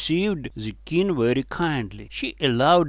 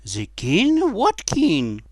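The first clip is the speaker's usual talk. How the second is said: put-on high, louder girlish query; surprised question; 7 semitones higher, 5 dB louder, slower than usual. A narrator's reading. Girls query the boy's claim, surprised high voice.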